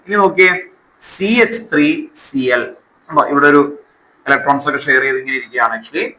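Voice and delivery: ordinary talk, nothing marked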